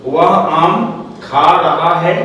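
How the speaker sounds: slow, word-by-word example sentence; slow dictation. Shown as a demonstration. Teacher repeating an example for students to follow, slow dictation. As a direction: spell it out slowly, pausing between words.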